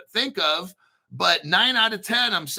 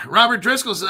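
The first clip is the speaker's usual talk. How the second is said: ordinary delivery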